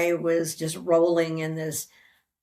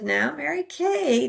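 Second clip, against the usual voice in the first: higher, slow, drawn-out address; lightly voiced friend, pitched up; retold coaxing friend; 9 semitones higher than usual. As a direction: coax her gently by name. Friend gently addresses her, retold.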